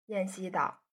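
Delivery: plain reading voice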